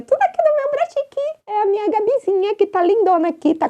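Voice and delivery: put-on high cooing baby talk. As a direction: coo at her like a baby. Cooing at doll.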